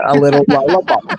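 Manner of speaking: playful Maine accent. Shown as a demonstration. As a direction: say it like a true Mainer. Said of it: "That's a true Maina talk there".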